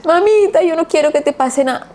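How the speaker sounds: put-on a child begging through sobs; crying child. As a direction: let the word break into tears. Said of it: "reventó a llorar".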